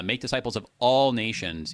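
"all" stretched and weighted over "nations"; stress on ALL. Shown as a demonstration. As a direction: lean hard on the word "all". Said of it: "you are actually reading it wrong".

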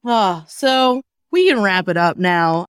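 ordinary talk

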